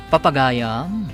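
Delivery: ordinary talk, nothing marked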